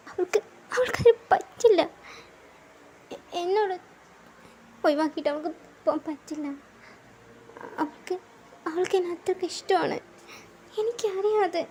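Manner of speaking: high, tearful voice, close to sobbing; crying. Acted. [name] crying to [name] that she can't leave. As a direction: plead through tears that she can't leave. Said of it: "നീ എന്തിനാ അതിന് കരയുന്നേ?".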